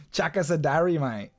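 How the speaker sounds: Bogan Aussie imitation; mock Australian Bogan request; rough Australian accent. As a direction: throw it out in broad Australian. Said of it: "rough Australian accent".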